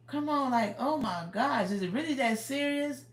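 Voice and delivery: high exasperated complaint. Real, not acted. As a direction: roll your eyes at it. Exasperated about rude people.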